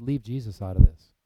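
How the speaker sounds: loud, hard fall on leave; angry shout. Retold or imitated. Father shuts down his son's Bible talk, loud and angry. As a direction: bark it at your son like a furious father. Dad forbids talk of Jesus.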